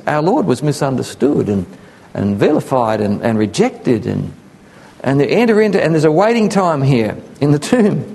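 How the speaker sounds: normal talking voice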